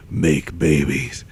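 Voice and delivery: deep, slow, drawn-out voice of a shadowy man; ominous dream stranger. Retold or imitated. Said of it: "the guy's like".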